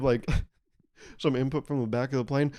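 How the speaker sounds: ordinary delivery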